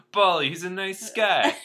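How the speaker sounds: loud, high, hammed-up Brooklyn accent. Rat vouching for his friend, loud mock Brooklyn accent. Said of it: "If a Brooklyn".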